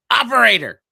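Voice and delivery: high, loud shout for the operator; yelling. Acted. Frustrated caller demanding a person from a phone menu, yelling. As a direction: yell it at the phone system. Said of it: "I'm the guy that yells".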